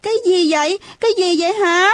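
put-on high, startled little-kid voice; narrated childish alarm. A narrator's reading. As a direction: ask in fright, pitched well up. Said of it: "mặt mày thất sắc".

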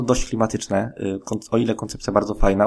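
ordinary talk

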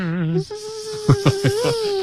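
high, steady mosquito whine; buzzing insect voice. Pretend. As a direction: hum one long high mosquito buzz. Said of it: "We are trying to do an impression of a mosquito".

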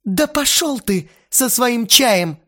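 high, loud, slowed contempt; angrily spat. A narrator's reading. Lena angrily spits back at Vova, loud and high. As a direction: spit the words at him. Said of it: "зло выплюнула Лена".

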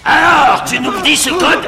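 loud, high threatening shout; bully him for the code; angry shout. Acted. Threatening the captive.

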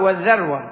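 "والذروة" with a short a after the article; fatha pronunciation. Shown as a demonstration. Teacher showing students the word's variants, fatha in "والذروة", dharwa. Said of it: "وفتحها".

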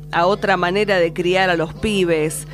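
normal talking voice

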